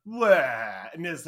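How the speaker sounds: loud mock "wah"; imitated wail. Describing feelings leaking out under spores, mock wail. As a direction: blurt a loud cartoonish wail. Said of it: "the inherent sort of just, like".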